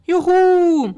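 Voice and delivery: high, loud whoop of triumph; jubilant cry. A narrator's reading. Knight finally gets the campfire going, whooping joyfully. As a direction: whoop it out, stretching the end. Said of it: "rief der Ritter".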